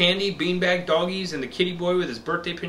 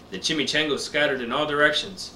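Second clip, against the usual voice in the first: ordinary delivery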